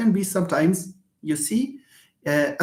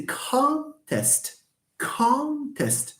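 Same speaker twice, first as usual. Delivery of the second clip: "contest" as CON-test, drawn out; stress on first syllable; 9 semitones higher, 4 dB quieter, much slower than usual. Shown as a demonstration. Teacher models the noun, "contest" with strong first syllable. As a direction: stretch and lift the "con" of "contest". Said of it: "you have con is the first syllable".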